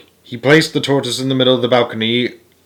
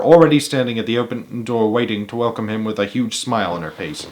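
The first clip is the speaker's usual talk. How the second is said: ordinary delivery, nothing marked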